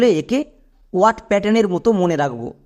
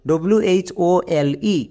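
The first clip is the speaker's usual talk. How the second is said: slow spelling out; much slower than usual. Shown as a demonstration. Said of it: "উচ্চারণ কী দাঁড়ালো".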